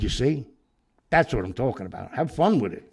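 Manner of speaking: egging him on, half shouting; lively retold urging; loud urging. Retold urging to play along.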